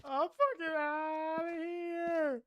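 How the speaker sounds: slow, high, screamed at full force; drawn-out panicked scream. Real, not acted. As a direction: scream it out in long drawn terror. Fleeing the ghost, screaming.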